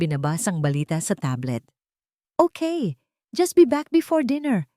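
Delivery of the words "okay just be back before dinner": higher, offhand husband's voice. Acted. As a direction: brush her off lightly, eyes on the tablet. Husband answers wife, distracted.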